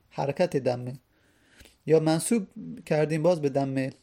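ordinary delivery

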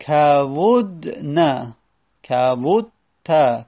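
each form drawn out slowly, syllable by syllable; slow spelled-out forms. Shown as a demonstration. Teacher drawls verb forms slowly.

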